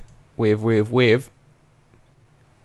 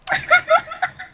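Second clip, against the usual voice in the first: high laugh. Real, not acted. Laughs after song.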